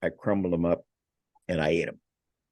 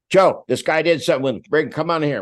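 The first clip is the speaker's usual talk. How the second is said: loud, higher call to a colleague; officer calling out; 9 semitones higher, 6 dB louder than usual. Retold or imitated. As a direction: bark it across the office.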